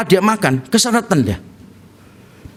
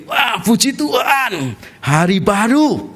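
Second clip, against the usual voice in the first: joyful waking; 3 semitones higher, slower than usual. Acted. Believer waking up praises God for a new day, acted joy. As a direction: greet the day with a long, slow praise. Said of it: "saudara, begini".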